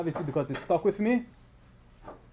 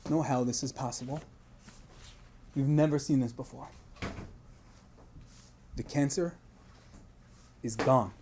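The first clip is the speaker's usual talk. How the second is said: much softer and lower, hushed wonder; hushed awe; 7 semitones lower, 12 dB quieter than usual. Retold or imitated. The husband telling the rabbi the miracle, hushed and low. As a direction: drop to a hush for the miracle.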